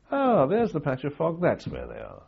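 a high, pleased "found them" voice; mock enemy forecaster. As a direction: play the gloating Argentine forecaster. Mimicking the Argentinian Met officer.

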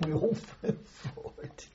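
breaking into laughter while telling it; laughing. Real, not acted. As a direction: let the words dissolve into chuckles. Laughs telling of tumbling forward.

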